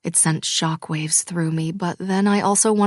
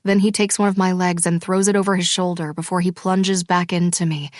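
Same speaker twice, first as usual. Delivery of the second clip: ordinary delivery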